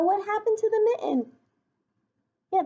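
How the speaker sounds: plain reading voice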